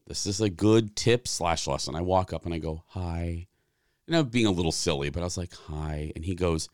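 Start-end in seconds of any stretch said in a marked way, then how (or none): 2.9-3.5 a soft, droopy little hi; playing pitiful to win the manager over; mock sad hi. Cast member greeting, said in a silly mock-sad softer voice. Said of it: "Why the sad face". 5.5-6.1 a soft, droopy little hi; playing pitiful to win the manager over; mock sad hi. Cast member greeting, said in a silly mock-sad softer voice. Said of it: "Why the sad face".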